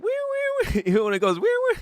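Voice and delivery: very high squealing alarm beeps; imitated alarm. Emergency exit alarm going off, high squealing imitation.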